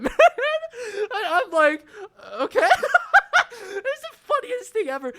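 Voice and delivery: very high, breathless laughing; can barely get it out for laughing. Real, not acted. Laughing hard at the end.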